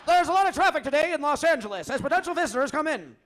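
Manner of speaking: fast, loud, high traffic-report voice; brisk, projected mock traffic bulletin; traffic reporter. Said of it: "thank you for that traffic report".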